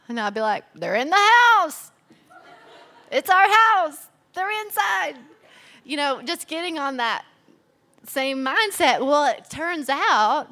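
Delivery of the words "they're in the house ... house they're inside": high, loud mock-indignant protest; playful indignant protest. Wife to husband about her shoes, mock-indignant and loud. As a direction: defend yourself with cheeky outrage, pitched up. Quoting herself defending shoes.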